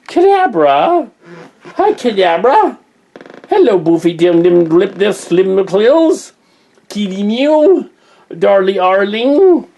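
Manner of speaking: very high, loud cooing to a cat; high-pitched, drawn-out kitty greeting; pet talk. Real, not acted.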